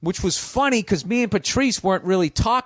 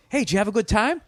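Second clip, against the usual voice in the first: bright mock-friendly host, higher; fake friendly host; 7 semitones higher, faster than usual. Host after the show, acting friendly to Bill, higher and mock-cheery. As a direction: play the host suddenly all nice. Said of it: "he goes".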